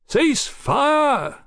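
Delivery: very high, ringing shout; shouted command. A narrator's reading. Captain halts his riflemen, shouting. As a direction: shout a drawn-out order to your troops. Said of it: "Swing shouted and laughed".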